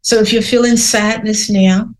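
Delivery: gentle, unhurried meditation guide; guided meditation. Acted. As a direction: lead listeners inward calmly. Leads a guided reflection.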